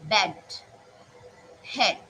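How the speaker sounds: "bed" and "head" said with a clear short e; teacher sounding out example words; example words demo. Shown as a demonstration. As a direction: model each word carefully. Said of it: "That is".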